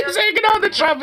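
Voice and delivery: talking through a real laugh, pitch jumping up; giggling in disbelief at the clip. Real, not acted. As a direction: laugh the words out, barely holding it together.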